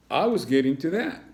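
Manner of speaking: high, loud stern principal; impatient school principal. Acted. Principal answers the cat.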